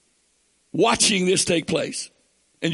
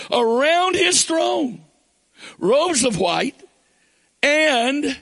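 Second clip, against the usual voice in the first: preaching at full force; 6 semitones higher than usual. Acted. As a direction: build it up like a revival preacher.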